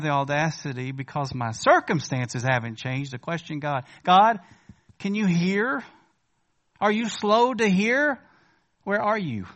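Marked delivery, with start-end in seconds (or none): 4.0-9.5 louder, higher mock demand; challenging prayer. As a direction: throw the accusing questions at heaven. Acted challenging prayer to God.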